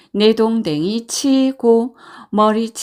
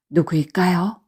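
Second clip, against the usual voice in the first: plain reading voice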